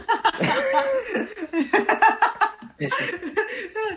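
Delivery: can't hold it in after the punchline; laughing at own joke. Real, not acted. Joke teller cracking up at his own punchline.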